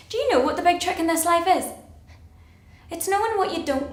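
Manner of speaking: high, loud pointed question; emphatic challenge. Acted. Challenging Arthur in a heated monologue.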